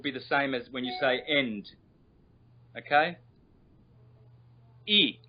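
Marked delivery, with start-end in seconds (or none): 4.9-5.2 "E" said as a pure "ee", not "eye"; isolated high "E" vowel, no glide. Shown as a demonstration. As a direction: sound out "E" as one long "ee". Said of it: "This is not I".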